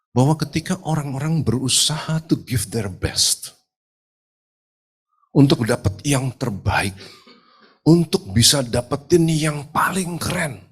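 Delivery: high, urgent sermon voice; impassioned preaching. Real, not acted. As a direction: preach it hard, pitched well up, stretching key words. Preaches with rising force. (khotbah berapi-api dengan nada tinggi)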